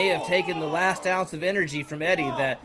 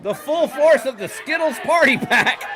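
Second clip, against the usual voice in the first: excited commentator; 7 semitones higher than usual. Acted. Candy attack, commentator hyping.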